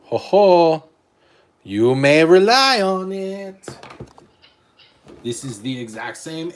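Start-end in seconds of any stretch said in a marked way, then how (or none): 0.0-0.9 loud, high ho ho; theatrical ho ho; booming mock laugh. Hamming up the eight ball. 1.6-3.7 much louder, higher and slow oracle; hammed-up mystic pronouncement; booming oracle voice. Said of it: "Magic Eight Ball".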